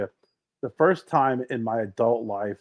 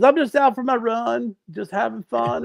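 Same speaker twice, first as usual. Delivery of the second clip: higher-pitched, lightly voiced Clinton; Bill Clinton impression; 11 semitones higher than usual. Comedian as Clinton telling McDonald's staff about his run, higher mock voice. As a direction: mimic Clinton's breezy jogger chatter, raised pitch. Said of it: "as Bill Clinton".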